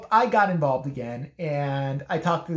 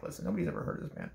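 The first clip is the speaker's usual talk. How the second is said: fast whispered aside; 10 dB quieter, faster than usual. Retold or imitated. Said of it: "and I'm like".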